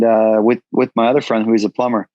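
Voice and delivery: normal talking voice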